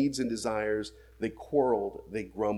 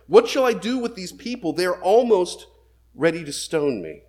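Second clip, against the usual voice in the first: higher and louder, pleading outcry; desperate cry; 5 semitones higher, 6 dB louder than usual. Acted. Moses crying out to the Lord about the people, loud and pleading. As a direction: cry out to God in alarm.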